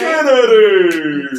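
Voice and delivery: stretched-out announcer call, loud; hype announcer. Pretend. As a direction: drag the name out like a ring announcer. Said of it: "Mister Kennedy".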